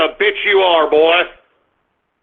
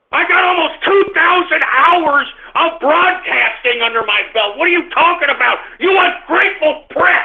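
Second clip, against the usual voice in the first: raised, higher voice, offended; indignant rant; 7 semitones higher than usual. Real, not acted. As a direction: fire back, genuinely insulted. Indignant rant at a chat commenter.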